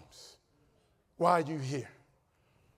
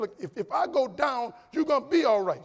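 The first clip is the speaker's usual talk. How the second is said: raised voice, pleading to be heard; insistent retold reassurance; 8 semitones higher, 6 dB louder than usual. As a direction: press it on a protesting spouse. Acted insisting to protesting wife.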